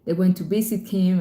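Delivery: usual speaking voice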